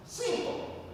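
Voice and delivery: put-on "Simple" tossed up in a high, breathy rise, Russian accent; rising simple. Russian landlord brushing off his brother's wealth, high breathy "Simple". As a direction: flip "Simple" upward like it is obvious.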